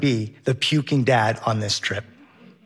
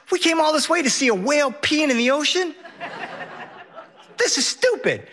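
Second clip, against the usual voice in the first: put-on whiny kid; 11 semitones higher than usual. Son complaining on whale boat.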